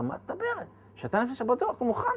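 can't believe she's ready already; incredulous surprise. Retold or imitated. Husband to wife two hours before Shabbat, incredulous.

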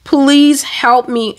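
high, drawn-out plea for help; slow, pleading re-enacted scream; retold scream. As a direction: stretch PLEASE into a mock cry. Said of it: "I started screaming".

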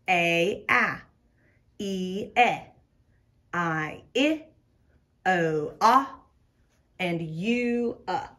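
each vowel's name, then its short sound; name the vowel, pause, sound it short. Shown as a demonstration. Teacher reviewing vowels with pupils, letter name then short sound. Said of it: "Short sounds are the sounds we know".

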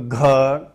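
घ voiced with a heavy breathy release; aspirated gha. Shown as a demonstration. Teacher contrasting ग with a breathy घ. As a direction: push air out on घ, slowly. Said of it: "अब आप इसको ग थोड़े बोलोगे".

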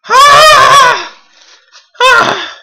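piercingly high, loud scream; pain scream. Real, not acted. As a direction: shriek as the burn hits. Screaming from the burn.

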